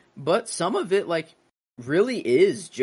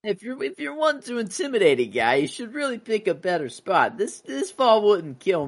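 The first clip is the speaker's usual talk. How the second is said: much higher, cocky mob boss voice; Falcone; 8 semitones higher than usual. Acted. Mobster dared on the balcony, high defiant impression. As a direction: taunt him in a raised, put-on voice. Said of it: "whenever he has Falcone".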